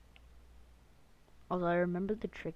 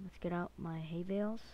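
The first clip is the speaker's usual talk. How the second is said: ordinary delivery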